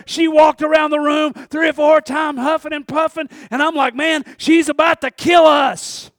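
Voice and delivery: loud, high storytelling; excited retelling. Real, not acted. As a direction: build the tension as she storms around. Tense retelling, boss storming.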